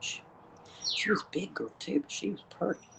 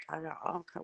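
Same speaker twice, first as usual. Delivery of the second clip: ordinary talk, nothing marked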